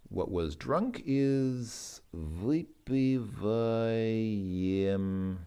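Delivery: vypivayemli drawn out slowly, each syllable clear; slow it right down on vypivayemli; slow careful pronunciation. Shown as a demonstration. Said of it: "to completely drink all of something".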